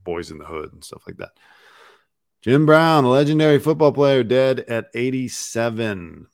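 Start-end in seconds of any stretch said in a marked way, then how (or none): 2.4-6.3 news announcer. Acted. Reading headline aloud.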